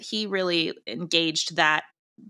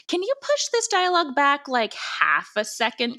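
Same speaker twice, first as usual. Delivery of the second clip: mock fussy director's note; 9 semitones higher than usual. Podcaster mimicking their own notes to the sound designer, higher and teasing. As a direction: voice jumps higher, half-teasing. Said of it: "We would give C J notes like".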